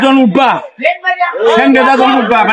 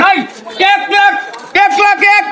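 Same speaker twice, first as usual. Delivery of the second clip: auctioneer; 4 semitones higher than usual. Acted. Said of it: "গাড়ি বিক্রি হবে নিলামে".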